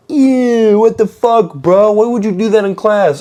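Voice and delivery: high, mocking "ew" voice of grossed-out teens; disgusted classmates.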